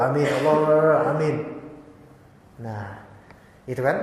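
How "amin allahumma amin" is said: fast mumbled amin, Allahumma amin; rushed careless prayer. Shown as a demonstration. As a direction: rattle off amin, Allahumma amin without care. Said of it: "berdoa asal-asalan".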